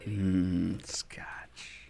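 breathy whisper of scotch; whispered savouring. Real, not acted. Savouring whiskey to cohost, whispered. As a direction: whisper it like a secret treat.

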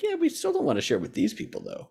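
high, soft mock voice, quick; mock reluctant aside. Mimics countries refusing to share.

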